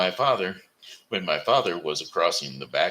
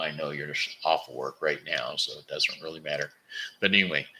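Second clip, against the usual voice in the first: ordinary delivery, nothing marked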